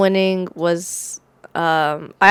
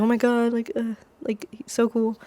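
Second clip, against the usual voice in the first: mocking gushing fans; 2 semitones higher, 7 dB quieter than usual. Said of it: "everyone's like".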